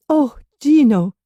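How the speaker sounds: high, loud relieved cry of his name; young woman crying out to Gino in relief; cried out. A narrator's reading. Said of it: "she cried".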